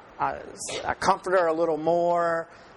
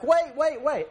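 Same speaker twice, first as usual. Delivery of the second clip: imitated little girl calling; 11 semitones higher than usual. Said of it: "And she's like".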